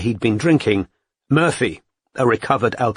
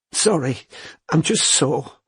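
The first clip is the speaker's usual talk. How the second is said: tearful; 5 semitones higher, slower than usual. A narrator's reading. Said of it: "Her voice broke".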